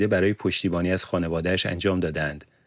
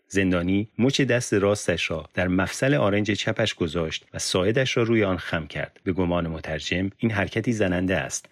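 plain reading voice

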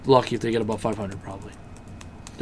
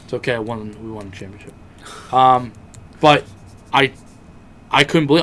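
normal talking voice

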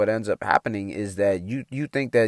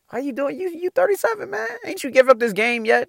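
a higher, put-on voice for the teasing grandpa; mocking grandfather. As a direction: needle him like an old man who thinks you should quit. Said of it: "That's what he said".

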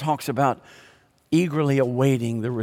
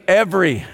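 Every said louder, punched hard; stressed every; 4 semitones higher, 5 dB louder than usual. Shown as a demonstration. Preacher insisting to the congregation on Every, stressed. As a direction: hit the word Every to drive it home. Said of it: "What does that mean?".

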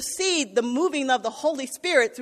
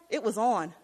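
normal talking voice

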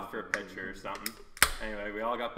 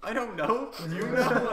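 exasperated mom; 12 semitones higher, 7 dB louder than usual. Retold or imitated. Mom answering on phone.

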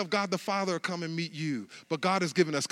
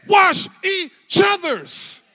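slow, high, loud quoted command; slow shouted command; 11 semitones higher, 10 dB louder, much slower than usual. Retold or imitated. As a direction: shout it slow like the words of the Lord. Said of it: "He said".

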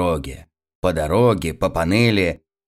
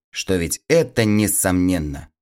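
plain reading voice

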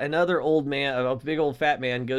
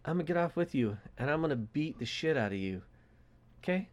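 soft, gentle-voiced threat; stone-cold calm; 8 dB quieter, faster than usual. Young guy calmly telling the old man what awaits him, hushed. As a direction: promise violence as quiet reassurance. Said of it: "say that, like, stone cold calm".

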